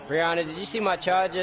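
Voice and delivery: calling out loud to another room; yelling across the room. Real, not acted. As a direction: raise the voice to reach the next room.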